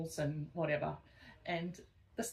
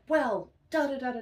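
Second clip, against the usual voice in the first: angry ranting; 7 semitones higher, 10 dB louder than usual. Retold or imitated. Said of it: "And he'd be like".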